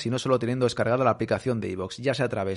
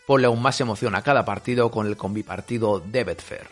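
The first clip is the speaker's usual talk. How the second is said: ad read; 3 semitones lower than usual. Acted. Host reads sponsor ad.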